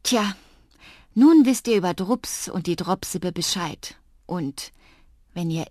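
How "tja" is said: ordinary delivery, nothing marked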